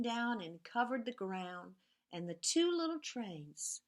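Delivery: lively storybook reading with whispered refrains; reading to children. A narrator's reading. Teacher reads Two Little Trains to the class, sing-song storytelling. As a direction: read it like a bedtime picture book, rhythmic and playful.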